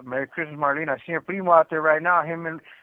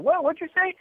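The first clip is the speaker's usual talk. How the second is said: sharp mom voice; 14 semitones higher than usual. Retold or imitated. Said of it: "my mom be like".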